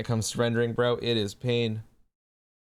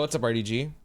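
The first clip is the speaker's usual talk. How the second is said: usual speaking voice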